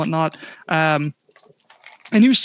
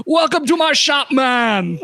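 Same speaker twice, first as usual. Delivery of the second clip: a rough try at a Jamaican shopkeeper, pitched up; playful put-on Jamaican accent, raised; attempted Jamaican accent; 5 semitones higher, 3 dB louder than usual. Dwarf shopkeeper greeting the party in a Jamaican accent. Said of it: "you sound like a troll from World of Warcraft".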